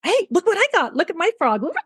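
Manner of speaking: put-on high, childlike show-and-tell; little girlfriends. Girlfriends showing each other things on set, childlike and high. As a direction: play a giddy little girl showing off. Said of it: "little girlfriends going".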